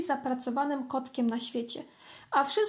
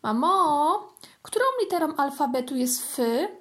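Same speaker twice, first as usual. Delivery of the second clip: higher, louder kitten calling his mother; kitten asking; 3 semitones higher, 4 dB louder than usual. Acted. Kitten calls out to his mother about the letter F. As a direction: call out the question to mum. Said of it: "zapytał".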